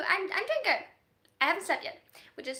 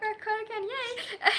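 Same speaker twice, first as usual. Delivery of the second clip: laughing; 9 semitones higher, 8 dB louder than usual. Real, not acted. Laughs at own slip-up.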